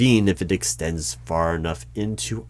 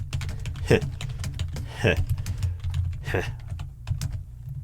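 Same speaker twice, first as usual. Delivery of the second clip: soft, spaced-out huffs of laughter; dry chuckle; 7 dB quieter than usual. Acted. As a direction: chuckle slowly under the breath. Interviewer reacts to firewalls joke.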